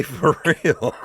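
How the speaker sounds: ordinary delivery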